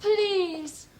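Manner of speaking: sharp rise in a desperate plea; pleading. Acted. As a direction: beg with one urgent cry. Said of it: "like a woman pleading for her lover".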